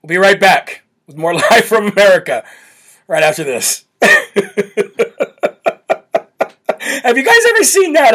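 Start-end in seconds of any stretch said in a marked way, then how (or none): 4.0-6.4 long rapid chuckle; real staccato belly laugh. Real, not acted. Laughing at himself before resuming. 7.0-8.1 high, giggly question; real laughter in the voice; talking through laughter. Real, not acted. Talking while still laughing.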